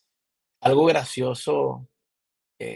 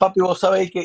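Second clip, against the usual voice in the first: laughing mock Zulian call, a bit higher and louder; joking Zulian papi; 6 semitones higher, 5 dB louder than usual. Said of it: "Yo le decía". (llamando al profesor "papi" entre risas, con tonito zuliano)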